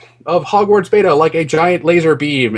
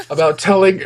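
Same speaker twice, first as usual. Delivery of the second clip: normal talking voice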